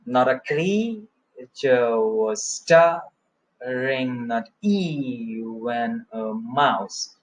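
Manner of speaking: very slow, stretched CREATURE, STIRRING, EVEN, MOUSE; anapestic scansion. Shown as a demonstration. Teacher checking the stresses, very slow exaggerated beat. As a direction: draw out every stressed syllable to show the beat. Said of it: "So if you check".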